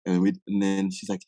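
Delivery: ordinary delivery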